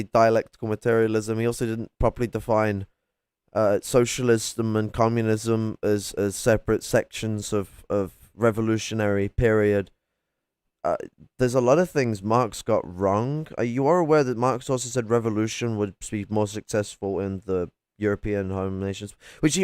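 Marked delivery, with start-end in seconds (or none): none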